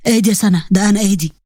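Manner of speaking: low male voice, steadying her; calming husband. Acted. Husband calming his startled bride in the dark, low. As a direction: drop low and settle her down.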